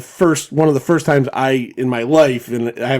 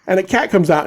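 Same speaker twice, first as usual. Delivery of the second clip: usual speaking voice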